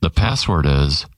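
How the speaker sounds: low, confiding game host aside; hushed password reveal. Acted. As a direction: drop the voice low to tell the home audience the secret word.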